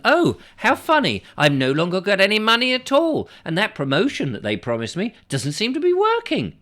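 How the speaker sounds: mock dismay. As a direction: play the duped caller, hammed-up. Mocking scam victim's realisation.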